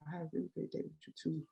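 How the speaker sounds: put-on hushed girly reply; imitating her softly. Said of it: "walk her back to the crib".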